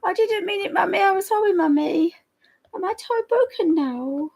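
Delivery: a guilty pup begging Mummy's forgiveness; Alfie's voice. Retold or imitated. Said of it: "Alfie talks like this".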